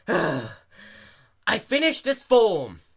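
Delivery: very slow, loud and high stunned reply; shocked. A narrator's reading. Dash shocked at the rude clerk, slow stunned. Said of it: "shocked at the employee's behaviour".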